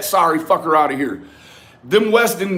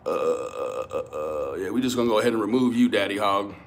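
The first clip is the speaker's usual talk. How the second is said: much lower, fast, softer put-on voice; mock deep official voice; 10 semitones lower, 7 dB quieter than usual. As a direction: play a deep-voiced bouncer kicking someone out, quickly. Mock removal of a chat user.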